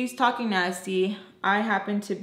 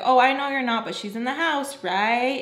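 higher-pitched mocking imitation; imitated whiny teacher; 3 semitones higher than usual. Upset teacher answering the cleaner, mocking higher voice. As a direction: mimic an upset employer pushing back. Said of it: "that's how they kinda would talk".

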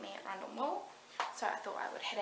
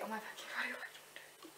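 whispering; 8 dB quieter than usual. Real, not acted. Whispered disbelief.

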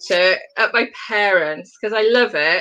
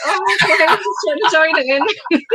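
mock innocent; 7 semitones higher than usual. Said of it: "I'm like".

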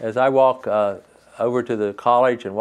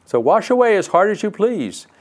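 cheerful brook; 5 semitones higher than usual. Acted. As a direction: sing it out like a happy brook. Brook generously invites fairy.

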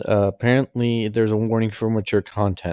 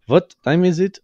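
put-on German accent, high and loud, hard V in "What"; 9 semitones higher, 5 dB louder than usual. Laura the German contender wakes and asks, German accent. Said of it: "it's because of her German accent".

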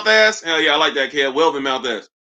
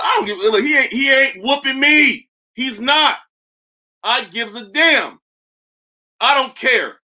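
higher, defiant hammed-up bravado; being extra; 5 semitones higher than usual. Real, not acted. Boasting to chat that nobody can beat him, higher and defiant. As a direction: puff up and act tough for the chat. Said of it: "I'm being extra right now".

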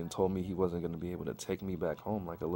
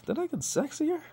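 high squeaky voice. Retold or imitated. Self-admiring in mirror.

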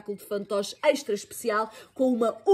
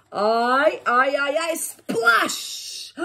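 alarm; 7 semitones higher, 4 dB louder, much slower than usual. A narrator's reading. Hair gets soaked in story.

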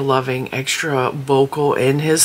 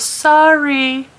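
high, loud, mock-innocent sorry; a sly little fake apology; teasing niece saying sorry; smirking sorry; 11 semitones higher, 4 dB louder than usual. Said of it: "She goes, smirks".

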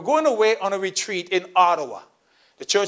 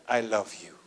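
tender; 10 semitones lower, 9 dB quieter than usual. Acted. Voicing love shown through patience.

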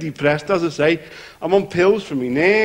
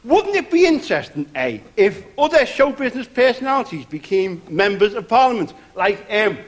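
ordinary talk, nothing marked

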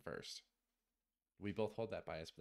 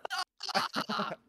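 loud high laugh; 10 dB louder than usual. Real, not acted. Laughs after teasing friend.

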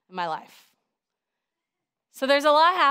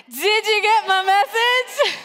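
high, slow, drawn-out shout; loud rallying shout; 10 semitones higher, 8 dB louder, slower than usual. Shown as a demonstration. Preacher retrying with a quiet congregation, shouting loud and high. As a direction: belt it out to rouse a quiet crowd. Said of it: "That was so quiet. Let's try it again".

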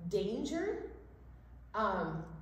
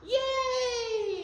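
excited cheer; 12 semitones higher, 6 dB louder than usual. Acted. Kids' storytime cheer.

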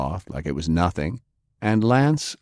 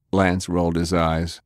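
ordinary delivery

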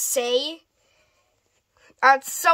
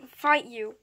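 ordinary delivery

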